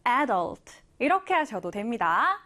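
normal talking voice

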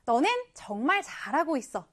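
ordinary talk, nothing marked

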